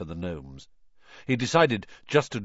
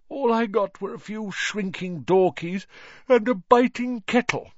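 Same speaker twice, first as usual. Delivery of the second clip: yawning; 12 semitones higher, 5 dB louder than usual. Acted. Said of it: "yawned Mister Weasley".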